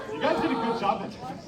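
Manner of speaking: usual speaking voice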